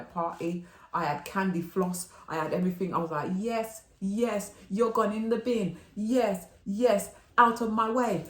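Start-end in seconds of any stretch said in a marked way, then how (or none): 3.4-8.2 higher, slow, gloating cheer; gleeful cheering. Retold or imitated. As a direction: cheer the wig into the bin. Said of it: "I was so happy".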